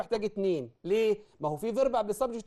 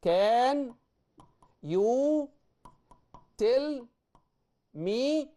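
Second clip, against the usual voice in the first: slow, higher, spaced words; 5 semitones higher, much slower than usual. Shown as a demonstration. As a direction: dictate it word by word. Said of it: "لو عايز أكمله".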